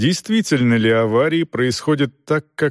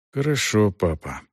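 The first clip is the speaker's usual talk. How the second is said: falling, resigned murmur; quiet, hopeless; 3 semitones lower, 3 dB quieter than usual. A narrator's reading. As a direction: give in quietly, sinking lower at the end. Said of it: "проговорил тихо и безнадёжно".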